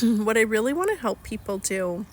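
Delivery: ordinary talk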